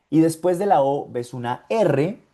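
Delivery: normal talking voice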